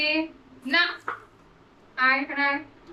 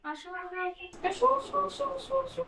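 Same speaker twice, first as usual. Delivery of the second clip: high coaxing call; 10 semitones higher, 7 dB quieter, much faster than usual. Real, not acted. Coaxing the dog to come.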